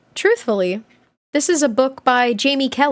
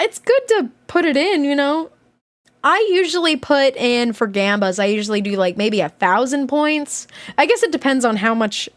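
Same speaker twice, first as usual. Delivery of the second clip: ordinary talk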